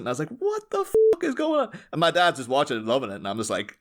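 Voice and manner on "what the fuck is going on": high, slow, baffled outcry; retold bewilderment. Telling friends of the odd accent, baffled. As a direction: stretch it out high in mock shock.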